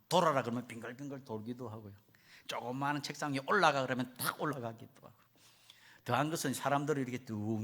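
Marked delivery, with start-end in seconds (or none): 0.1-0.4 mock trainer's command. Trainer orders the elephant. 3.5-3.8 mock trainer's command. Trainer orders the elephant.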